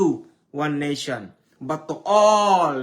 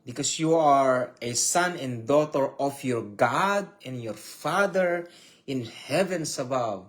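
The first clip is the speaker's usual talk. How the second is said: ordinary talk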